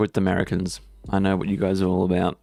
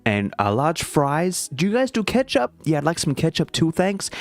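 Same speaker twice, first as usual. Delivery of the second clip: put-on slow, higher, over-pronounced American accent; 5 semitones higher, slower than usual. Ordering at McDonald's in a careful mock American accent. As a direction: order like an American, slowly, every word spelled out. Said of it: "it sounds like I'm impersonating someone".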